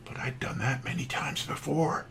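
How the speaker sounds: a sheepish whispered confession; whispered aside. Real, not acted. Speaker confessing to the audience, whispered aside. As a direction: drop to a whisper to admit it. Self-mocking aside about promises.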